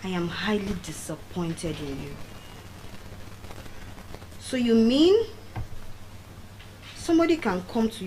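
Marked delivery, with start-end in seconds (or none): 0.0-2.1 low, soft disapproval; disappointed. Retold or imitated. The two boys scolding her, low and soft disappointment. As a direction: quietly let them down. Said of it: "looked at me like this".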